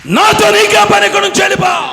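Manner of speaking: very high, loud dismissal; full-force acted yell; shouted confrontation. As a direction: scream it to drive someone away. Acted confrontation between Satan and Jesus.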